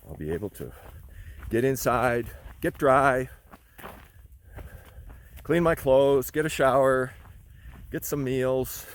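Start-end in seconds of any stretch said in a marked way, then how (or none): 1.5-8.9 excited. Real, not acted. Excited about a room tonight.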